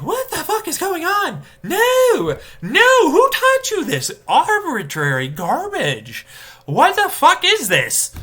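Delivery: high, loud, slow exasperated wail; a hammed-up falsetto rant; mock outraged shriek. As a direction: shriek it in disbelief at their rules. Ranting quote of own outburst.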